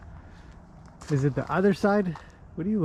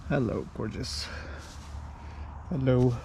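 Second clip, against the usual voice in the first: normal talking voice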